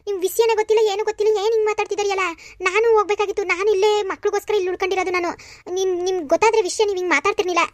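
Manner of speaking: high-pitched, affronted retort; indignant protest. Acted. Accused sister-in-law defends staying home with children, indignant. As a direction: push back hurt and indignant at the accusation.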